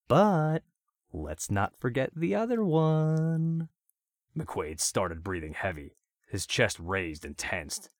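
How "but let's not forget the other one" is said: high, slow, sing-song menace; sinister singsong. A narrator's reading. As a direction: tease the next cut sweetly. Villain threatens again.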